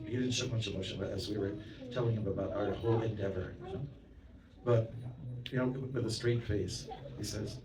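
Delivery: normal talking voice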